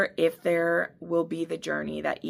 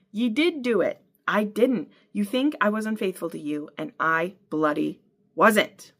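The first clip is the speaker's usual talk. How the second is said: furious tirade; 4 semitones higher than usual. Acted. Claire's furious tirade at Jamie.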